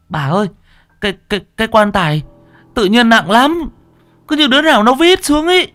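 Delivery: bewildered, high-pitched complaint; alarmed young bearer. Acted. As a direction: stammer out the trouble in a rising panic. Alarmed bearers report to old woman.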